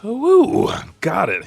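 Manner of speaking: high, loud "woo"; whoop, then drop a little for the rest; triumphant whoop. Retold or imitated. Somers pulls his heart back, whooping. Somers goes, acted exclamation.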